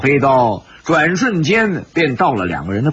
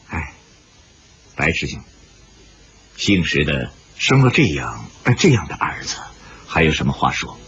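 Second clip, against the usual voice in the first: resigned, ashamed father; 10 semitones lower than usual. A narrator's reading. Shi Qing offers to take his son to face punishment, downcast. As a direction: sigh and give in with lowered voice.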